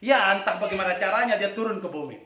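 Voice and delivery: ordinary delivery, nothing marked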